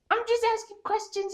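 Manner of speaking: very high mock whimper; squeaky, hammed-up wounded protest; whiny upset mom. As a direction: imitate mom pleading innocence. Imitates mom whining in the argument.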